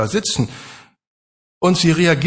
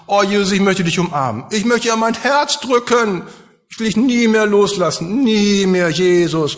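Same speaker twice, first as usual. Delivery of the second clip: higher, rushing, yearning; eager longing; 5 semitones higher than usual. Acted. Maria longing to embrace the risen Jesus, eagerly. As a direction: plead to hold him forever. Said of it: "Denn ihr Impuls ist".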